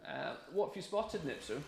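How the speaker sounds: high, soft voice for the dog; talking to the dog. Real, not acted.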